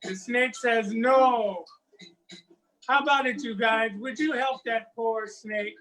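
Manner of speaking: lively, sing-song folktale telling; storyteller spinning a West African folktale; story narration. A narrator's reading. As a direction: tell it to a circle of children.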